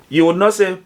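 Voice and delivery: ordinary delivery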